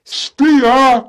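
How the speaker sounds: high, loud barked order; shouting. Acted. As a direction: bellow the command. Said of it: "shouts Harry Bo".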